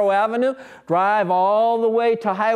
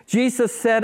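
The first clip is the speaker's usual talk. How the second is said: usual speaking voice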